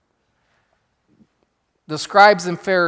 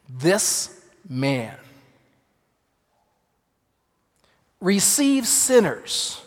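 disdain; much slower than usual. Retold or imitated. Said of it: "Can you hear the disdain in their voices?".

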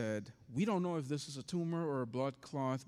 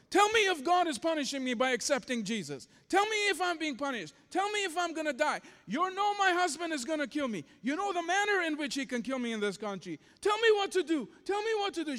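much higher and louder, begging; retold screaming plea; 13 semitones higher, 6 dB louder than usual. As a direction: scream the plea with raw desperation. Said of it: "screaming top of her lungs".

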